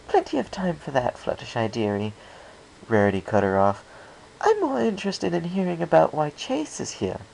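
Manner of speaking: smooth over a shy friend, a touch genteel; lightly voiced Rarity. Rarity cuts Fluttershy off.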